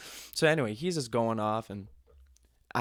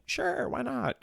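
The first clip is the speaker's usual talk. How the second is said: high, drawn-out "sure"; mock easygoing acceptance; slower than usual. Younger self accepts a drink, high carefree voice. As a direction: pitch it up, happily giving in.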